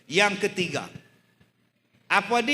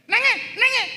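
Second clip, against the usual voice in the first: shrill high mock scold, much higher and louder; scolding grandmother; 7 dB louder than usual. Grandmother scolding crying grandchild, high mock scold. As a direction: mimic a cross granny. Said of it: "marah pada cucu kita".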